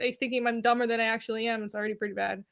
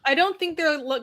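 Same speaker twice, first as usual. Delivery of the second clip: usual speaking voice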